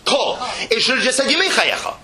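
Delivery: ordinary delivery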